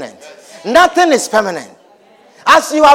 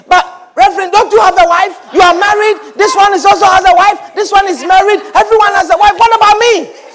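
higher, louder pleading protest from a bitter brother; angry complaint; 9 semitones higher, 4 dB louder than usual. Retold or imitated. Church brother protests to the pastor, angry and pleading. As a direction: demand it like he's been cheated. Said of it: "He was very angry".

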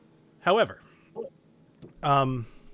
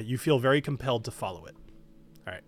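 normal talking voice

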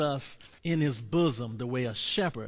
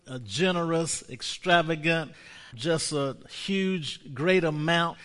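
ordinary delivery

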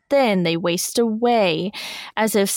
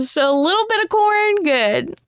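laughing; 9 semitones higher than usual. Real, not acted. Laughing joke explanation.

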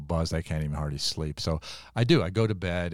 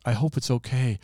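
little voice; 5 semitones higher than usual. Retold or imitated. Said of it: "I hear a little voice".

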